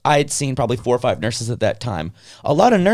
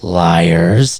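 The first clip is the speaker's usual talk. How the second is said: mock accusation; 8 semitones lower, 6 dB louder than usual. Real, not acted. Accusing the hospital staff, jokingly.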